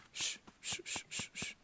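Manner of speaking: barely audible repeated shushes; tender divine hushing. Acted. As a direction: hush someone weeping in your arms. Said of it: "que escuches que te diga". (siseo tierno, muy bajito, para consolar)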